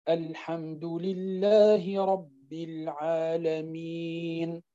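slow recitation, the ي in العالمين held for four counts; medium vowel length. Shown as a demonstration. Teacher demonstrating four-count tawassut to students. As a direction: chant العالمين with a medium madd on the ي. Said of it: "وأنك تقراه بالتوسط".

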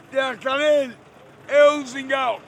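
loud, defiant public denunciation; defiant declaration. Real, not acted. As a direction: declare it loudly for the camera, word by word. Declares insult publicly for the camera.

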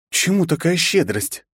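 spiteful smirk. Acted. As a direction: sneer it with spite. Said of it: "злобно усмехнулась княжна".

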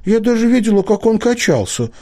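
high, loud, insisting; insistent. A narrator's reading. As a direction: insist she saw it move. Girl insists, frightened.

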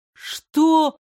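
high, loud startled cry; a disbelieving yelp; shocked. Acted. Prince hearing the empress named, shocked cry.

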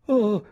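high, loud gasped cry; gasping oh. Acted. Nora on the stone bench, a high gasp. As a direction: gasp as if about to faint. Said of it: "ansimò Nora".

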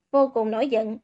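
ordinary delivery, nothing marked

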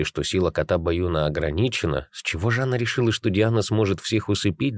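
usual speaking voice

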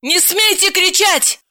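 high-pitched, stern female voice; offended woman, pitch way up; indignant rebuke. Acted. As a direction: forbid him indignantly. Heroine rebukes shouting man.

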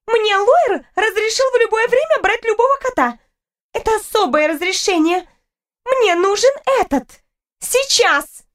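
put-on high, insistent young woman; imperious high-pitched demand; haughty rival girl, demanding. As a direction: insist on your privilege in a high, angry voice.

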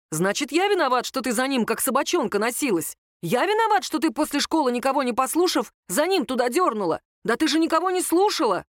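exasperated brother arguing back; indignant quarrel. A narrator's reading. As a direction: throw the blame back at sister. Brother rages in acted family quarrel.

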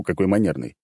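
ordinary delivery

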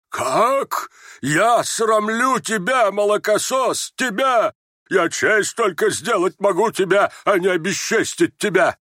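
high, drawn-out indignation; outraged shouting. Acted. Outraged general answers his son's rebuke. (возмущённый крик оскорблённого отца)

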